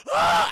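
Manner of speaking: short loud scream of fright; loud retold shriek; scared kid scream. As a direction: yelp like a terrified little kid. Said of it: "I get so scared".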